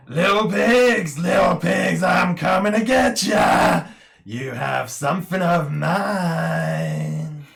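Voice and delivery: booming monster roar through megaphone; villain roar. Acted. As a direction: roar it out, high and loud. Villain roaring through a megaphone device.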